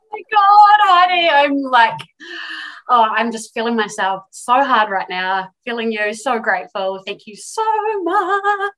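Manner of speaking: high, loud delighted squeal. Real, not acted. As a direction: gush with real delight. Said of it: "You've just done your very first interview".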